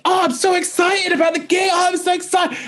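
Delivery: very high squealing shout, imitating himself; excited. Comedian in green rooms before a gig, over-excited squeal. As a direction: squeal it out, far above your normal voice. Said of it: "when I get excited".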